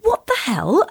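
high, baffled retold exclamation; confused. Reacting to the date's admission, confused. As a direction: pitch it up in bewilderment. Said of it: "I was just so confused".

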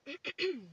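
quick throat clear. Real, not acted. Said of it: "Excuse me, y'all".

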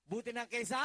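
loud teasing fill-in-the-blank; prompting the crowd. Real, not acted. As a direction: call out and wait for the answer. Preacher prompts congregation to finish.

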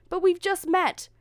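high, loud plea; protesting. A narrator's reading. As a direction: protest as she pulls away. Prince protests her sudden departure.

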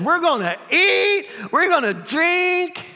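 high, loud, drawn-out holler; mock boastful cry. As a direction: stretch "eat" and "drink" way up high as the rich fool. Voices the rich fool's boast.

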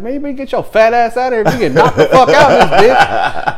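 loud, high, laughing threat; mock angry threat. Brooklyn soldier threatening the civilian, mock anger. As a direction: imitate someone chasing a man off.

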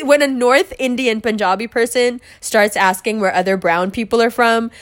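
normal talking voice

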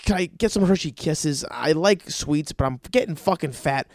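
normal talking voice

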